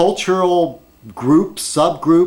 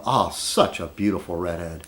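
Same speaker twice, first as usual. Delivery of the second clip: dreamy admiration; 8 semitones lower, 7 dB quieter than usual. Real, not acted. Vlogger swooning over the Doctor Who redhead, low and dreamy. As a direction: lower and softer, lingering on oh.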